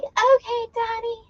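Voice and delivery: high, squeaky fangirl reply; fan voice. Retold or imitated. Crowd of fans answering Donnie, high and eager. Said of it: "And we're like".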